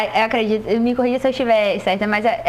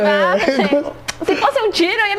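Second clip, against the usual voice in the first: mock mother voice; 5 semitones higher than usual. Imitating mother.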